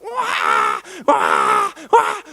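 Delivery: loud mock baby cry; imitated baby wail. Preacher imitating life's loud demands, loud baby wailing. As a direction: wail like a hungry newborn. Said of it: "everything else seems to be loud".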